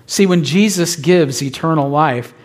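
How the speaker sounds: ordinary talk, nothing marked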